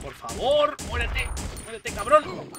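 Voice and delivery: high, loud, desperate urging; frantic plea. Real, not acted. Player begging an enemy to die, frantic plea. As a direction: plead frantically, voice jumping up.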